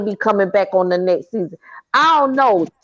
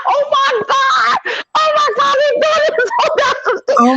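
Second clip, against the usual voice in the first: shocked squeal; 14 semitones higher than usual. Retold or imitated. Watching the show, high excited squeal. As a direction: squeal like you can't believe it. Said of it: "I was like".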